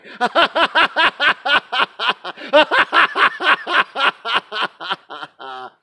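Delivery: sustained high, loud laughter; full-throated meditation laugh; long loud belly laugh. Shown as a demonstration. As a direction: let the laugh roll out on and on.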